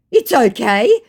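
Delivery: lightly mocked, high and loud with a rise; scammer's brush-off. Scammer brushing off an insult, lightly mocked. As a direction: pitch it up as a put-on reply.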